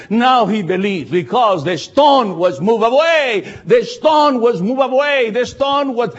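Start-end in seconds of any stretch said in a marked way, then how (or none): 1.1-3.5 "stone" stretched and slow; stress on stone. Shown as a demonstration. 3.6-5.3 "stone" lifted high and slow; stress on stone. Shown as a demonstration. Repeated, higher and slower.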